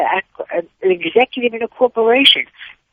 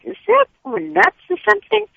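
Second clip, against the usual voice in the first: much higher, imitating a sneering shopper; high mocking disbelief; mock incredulous stranger; 13 semitones higher than usual. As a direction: play the stranger who thinks she's crazy, pitched up high. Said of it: "They look at you like".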